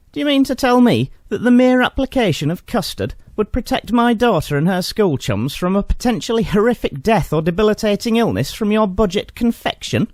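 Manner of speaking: indignant challenge. Retold or imitated. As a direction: grill the clerk in disbelief.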